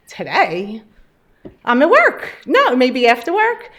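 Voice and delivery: bursting out that she's at work; retold surprise. Patient retells answering the doctor's call, surprised and loud.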